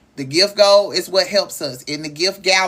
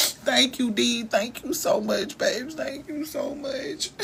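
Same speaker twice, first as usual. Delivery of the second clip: slow, tearful gratitude; real crying; 4 semitones higher, 4 dB quieter, slower than usual. Real, not acted. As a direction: thank them through tears, fading softer. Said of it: "I'm sorry for crying".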